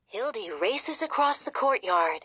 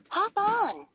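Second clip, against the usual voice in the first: high, bouncy rabbit; a friendly bunny offering a ride; 11 semitones higher than usual. Acted. Clover, the rabbit, speaks.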